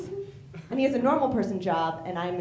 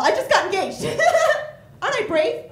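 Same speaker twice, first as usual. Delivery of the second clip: fishing for applause; 11 semitones higher, 7 dB louder than usual. Pretend. Mock bid for applause.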